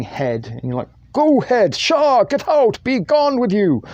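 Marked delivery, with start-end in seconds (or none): 1.1-1.7 high, loud Irish shooing cry; Irish accent. Acted. Shooing away a floating head, high loud Irish accent. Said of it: "Don't know why you sounded Irish". 1.7-3.8 high, loud Irish shooing cry; Irish accent. Acted. Shooing away a floating head, high loud Irish accent. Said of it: "Don't know why you sounded Irish".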